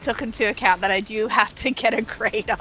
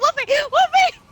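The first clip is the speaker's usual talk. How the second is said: calling out; 6 dB louder than usual. Retold or imitated. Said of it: "I go".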